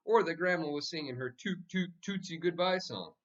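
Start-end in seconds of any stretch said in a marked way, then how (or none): none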